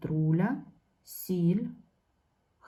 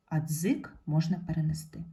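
ordinary delivery

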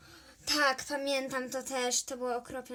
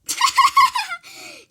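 shrill-pitched loud cackle, mocking; mean pupil cackling at the teacher; high mocking cackle; 15 dB louder than usual. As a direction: laugh in her face, high and loud. Villainous laugh at teacher.

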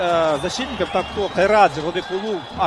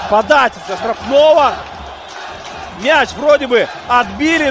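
excited replay; 4 semitones higher, 6 dB louder than usual. Real, not acted. Replay of goal, still elated.

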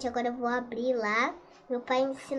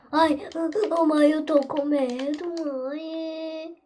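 scared; 5 dB louder, slower than usual. Acted. Child scared in horror game.

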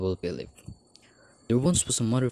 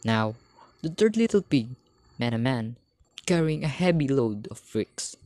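ordinary delivery

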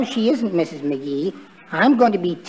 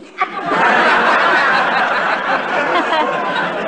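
long giggle; 7 semitones higher than usual. Acted. Wimple chuckles at own joke.

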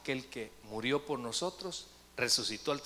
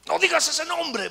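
high, loud demonic shriek; imitated demon screaming in protest; possessed woman's voice; 13 semitones higher, 12 dB louder than usual. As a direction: shriek it as a demon repelled by the name. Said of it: "la voz de ella había cambiado".

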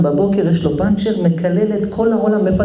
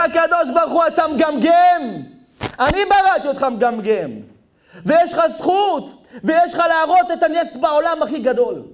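high, ringing proclamation; full-force preaching; 10 semitones higher than usual. Acted. God addressing the stutterer he created, full-force preaching. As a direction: preach it high and forceful, stretching key words.

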